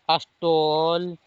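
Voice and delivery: teacher modelling अस्टोल with a long o; slow demo word. Shown as a demonstration. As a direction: spell out अस्टोल very slowly. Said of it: "जिसमें टोल".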